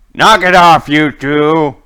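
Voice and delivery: loud, high commanding yell; shouting. Acted. Guard telling two brawlers to stop, commanding shout. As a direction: shout to break up a fight. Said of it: "and he's shouting".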